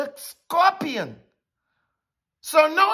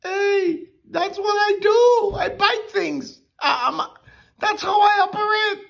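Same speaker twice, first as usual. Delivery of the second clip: scorpion's voice; 11 semitones higher than usual. Retold or imitated. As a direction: brush him off like the scorpion. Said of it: "The scorpion said".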